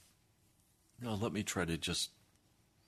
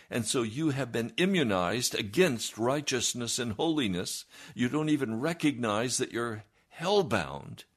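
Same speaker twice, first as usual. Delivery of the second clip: fiery preaching; 5 semitones higher, 5 dB louder than usual. Retold or imitated. Pastor retells his rebuke.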